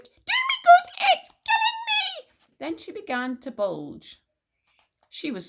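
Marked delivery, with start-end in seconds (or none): 0.0-2.3 high, loud anguished shriek; screaming. A narrator's reading. Grandma protesting at the medicine, high scream. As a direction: screech it in outrage, slowing at the end. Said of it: "she screamed".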